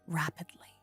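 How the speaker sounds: usual speaking voice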